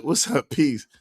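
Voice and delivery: usual speaking voice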